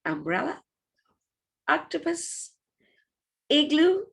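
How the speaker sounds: each word said quick and high, vowels short; short vowels clipped. Shown as a demonstration. Teacher showing the class how short vowels sound. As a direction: clip every short vowel. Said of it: "And the short one goes".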